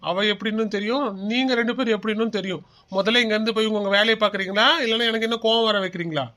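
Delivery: ordinary talk, nothing marked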